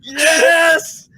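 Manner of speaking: high, loud gleeful yelling; excited shouting. Real, not acted. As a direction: yell it out in pure delight. Excited yelling about content.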